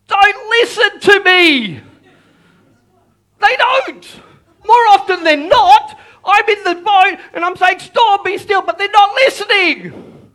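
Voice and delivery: loud, high cry of frustration; exasperated shout. Acted. Preacher confesses his storms ignore him, exasperated shout. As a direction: shout it, stretching the key words.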